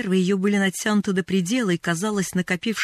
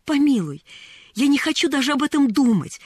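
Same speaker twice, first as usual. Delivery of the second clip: higher, pleading refusal; distressed protest; 7 semitones higher, 3 dB louder than usual. A narrator's reading. Mrs. Carew refuses to think of it, distressed. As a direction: cut the subject off in distress. Woman begs girl to stop.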